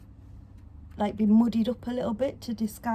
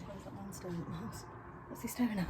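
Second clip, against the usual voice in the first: hushed; 13 dB quieter, faster than usual. Real, not acted. Noticing a stranger outside.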